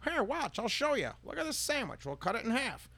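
very high, sing-song demo voice; Rosie demonstrating. Retold or imitated. Rosie showing the customer her sandwich trick, high and cheery. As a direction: show off the sandwich like a cheery ad lady. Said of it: "Rosie's at the diner".

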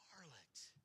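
barely audible whispered aside; hushed whisper. Real, not acted. Preacher stressing a shocking word to the congregation, hushed whisper. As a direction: whisper the word under your breath.

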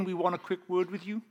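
usual speaking voice

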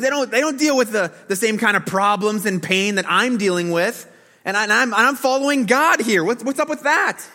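high, loud whiny complaint; whining. Retold or imitated. Pastor voicing Asaph whining to the congregation, high and whiny. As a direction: whine like a man who feels cheated. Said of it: "vision him whining here".

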